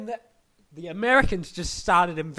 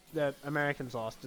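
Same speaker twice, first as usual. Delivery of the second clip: ordinary talk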